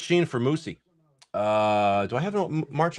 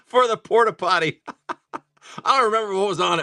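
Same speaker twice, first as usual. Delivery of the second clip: high, laughing punchline; real giggle breaking into the words; 7 semitones higher than usual. Real, not acted. Storyteller reveals his buddy's porta potty soundtrack, laughing.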